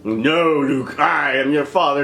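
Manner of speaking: Vader imitation. Quoting Darth Vader's famous line.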